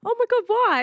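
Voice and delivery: high, loud alarmed question. Retold or imitated. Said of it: "And I'm like".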